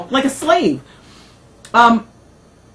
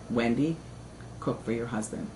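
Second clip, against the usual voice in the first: much lower and softer, a grave fatherly order; stern father; 11 semitones lower, 14 dB quieter than usual. Retold or imitated. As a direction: lay down the law quietly, deep in the chest. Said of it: "My father says".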